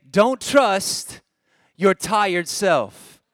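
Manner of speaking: loud, slow, for a crowd to repeat; deliberate call to echo; emphatic model phrase. Shown as a demonstration. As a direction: break it into two drawn-out chunks. Models the line for congregation repeating.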